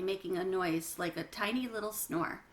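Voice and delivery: ordinary delivery, nothing marked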